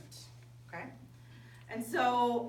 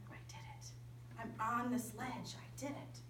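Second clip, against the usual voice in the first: breathless whispered triumph; awed whisper; 9 dB quieter than usual. Retold or imitated. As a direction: whisper it in awe at the top.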